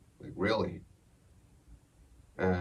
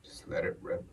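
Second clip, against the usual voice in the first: whispered quote; 7 dB quieter than usual. Retold or imitated. Quoting brother's advice.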